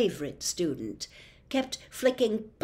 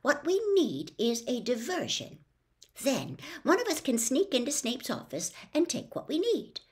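brisk; 6 semitones higher than usual. A narrator's reading. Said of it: "said Hermione briskly".

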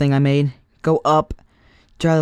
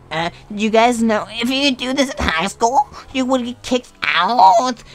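slow, higher mock warning teacher; mock teacher warning about high school, slow and higher; imitating the teacher; 7 semitones higher, 3 dB louder, slower than usual. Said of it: "And she was like".

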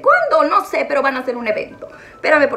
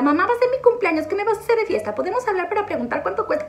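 put-on higher, fast pleading child voice; imitating daughter; 7 semitones higher, faster than usual. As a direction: play the eager little girl begging. (imitando a la hija, aguda y rápida)

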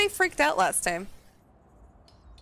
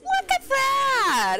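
high, slow, drawn-out marvel; stretched-out gleeful look at that; delighted exclaim; 13 semitones higher, much slower than usual. Real, not acted. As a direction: show it off with wonder. Marvelling at finished storm.

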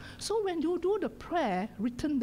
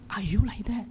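ordinary talk, nothing marked

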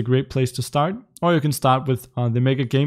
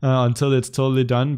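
ordinary talk

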